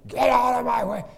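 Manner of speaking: a high, loud furious scream. Retold or imitated. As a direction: scream at the man blocking the door. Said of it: "this man was screaming".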